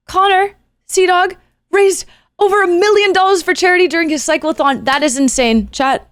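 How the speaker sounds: cheering the big total for chat; excited shout-out. Real, not acted. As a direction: hype up the charity news. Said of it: "Chat, please clap".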